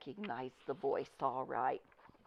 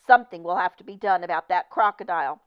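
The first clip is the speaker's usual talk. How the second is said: angry warning; 10 dB louder, faster than usual. Acted. Manager threatening.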